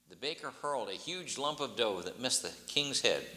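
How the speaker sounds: plain reading voice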